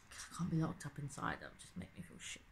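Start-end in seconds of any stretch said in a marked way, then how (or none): 1.4-2.4 much lower unvoiced whisper. Real, not acted. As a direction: mutter it to yourself. Gloomy aside.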